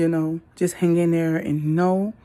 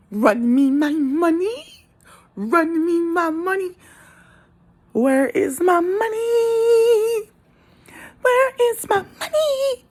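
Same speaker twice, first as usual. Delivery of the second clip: high, slow whiny demand building to a mock cry; mock money-demanding mother; 13 semitones higher, slower than usual. Imitating the mother demanding money, whining into mock crying. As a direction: wail for your money, hammed-up.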